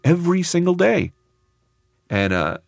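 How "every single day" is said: higher, lecturing nurse imitation; scolding nurse. Nurse scolding the crying narrator, imitated higher. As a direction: mimic the blunt nurse telling you off. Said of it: "And she was like".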